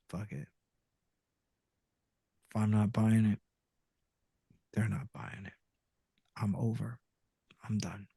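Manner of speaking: low and soft, giving up; defeated murmur. Retold or imitated. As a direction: let it drop, resigned and quiet. Giving up at the microphone.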